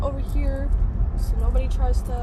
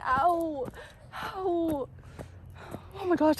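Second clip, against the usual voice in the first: normal talking voice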